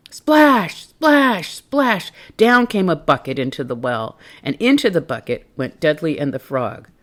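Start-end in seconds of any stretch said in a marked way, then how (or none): 0.1-2.1 splash sounds. Acted. Sound effect read.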